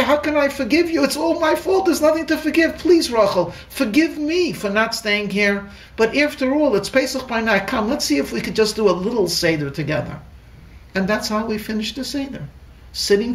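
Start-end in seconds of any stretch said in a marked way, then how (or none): 0.0-10.2 higher, earnest, begging; pleading apology. Retold or imitated. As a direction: beg forgiveness, all blame on himself. Husband pleading with wife.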